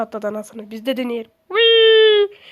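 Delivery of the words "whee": loud, high joyful whee; real excited whoop; gleeful whee. Real, not acted. Jumping in game.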